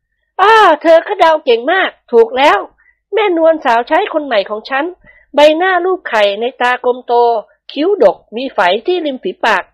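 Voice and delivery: high, gentle ladylike voice; high-voiced mistress of the house, pleased; lady of the house. A narrator's reading. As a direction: voice the madam sweetly pleased with her hair. Thada confirms guess.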